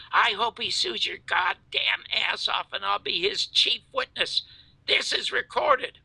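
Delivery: high-pitched angry threat, re-enacted; retold scream. Threatening the producer over Garrison, retold angry scream. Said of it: "I scream at him".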